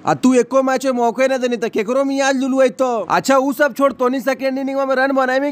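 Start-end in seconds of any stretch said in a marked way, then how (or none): none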